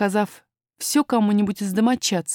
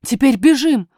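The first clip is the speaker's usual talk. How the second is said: louder, higher nurse's call; raised, urgent voice; urgent exclamation; 5 semitones higher, 7 dB louder than usual. A narrator's reading. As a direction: urge them to run at once. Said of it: "воскликнула няня".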